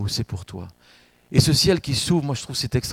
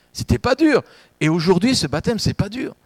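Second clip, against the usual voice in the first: high, loud insistence that it is easy; emphatic exclamation; 7 semitones higher, 4 dB louder than usual. Acted. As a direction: shout the good news up to the congregation.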